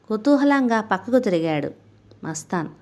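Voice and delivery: usual speaking voice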